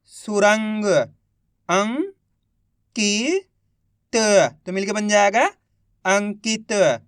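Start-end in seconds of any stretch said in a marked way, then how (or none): 1.7-4.6 each syllable drawn out very slowly; slow syllable-by-syllable reading. Shown as a demonstration. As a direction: sound out सुरंग slowly as सु-रं-ग, one sound at a time. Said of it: "एक एक अक्षर का उच्चारण करके".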